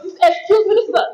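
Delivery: laughing mock scolding; laughing as the rude colleague. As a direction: play the insult through laughter. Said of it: "Person says".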